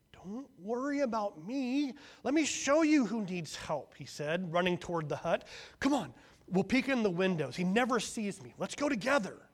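high, loud eager urging; eager coaxing. A narrator's reading. Fault-finding son coaxing firstborn to spy on hut, excited. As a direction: hurry him along excitedly.

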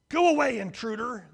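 loud, high jeering shout; demanding shout. A narrator's reading. Savages at the window yelling at firstborn, loud. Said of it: "they demanded".

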